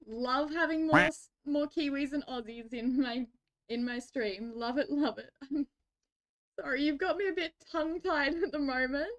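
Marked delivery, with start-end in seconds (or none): none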